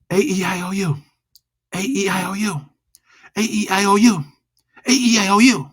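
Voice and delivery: rising, building vowel drill. Shown as a demonstration. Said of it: "Loud and fast".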